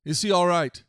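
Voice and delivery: insistent king; demanding. A narrator's reading. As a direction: press for an answer, loudly. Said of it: "the king demanded".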